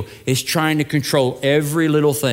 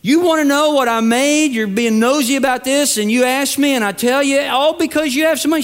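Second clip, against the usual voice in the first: angry dad; 6 semitones higher than usual. Retold or imitated. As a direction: scold the nosy son, voice climbing high. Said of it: "the dad got really angry at that".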